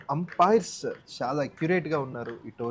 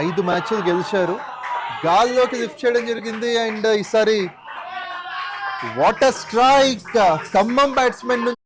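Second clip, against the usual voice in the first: excited commentary; 7 semitones higher, 7 dB louder than usual. Real, not acted. Live commentary on a big shot.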